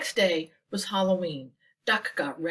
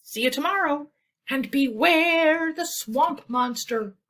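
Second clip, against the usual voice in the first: spooky warning; 7 semitones higher, 7 dB louder than usual. Acted. Thistle calling back a spooky warning to Goose. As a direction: stretch it out like a ghost story. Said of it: "she called back behind her".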